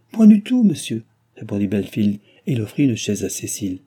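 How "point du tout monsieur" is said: high, loud polite protest; emphatic denial. A narrator's reading. Host answers the unexpected visitor, emphatic denial. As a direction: protest at once, pitch leaping up. Belfield politely denies any intrusion.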